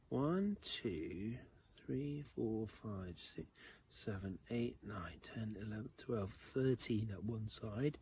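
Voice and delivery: put-on counting aloud slowly for kids; teaching a child to count. Shown as a demonstration. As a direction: count each grain out for children. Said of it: "can you count with me".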